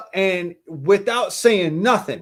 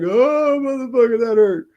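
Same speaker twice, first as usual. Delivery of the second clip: high, pained yelp of surprise; surprised ouch; 7 semitones higher than usual. Retold or imitated. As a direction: react like a kid just punched in the mouth. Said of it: "I'm just like".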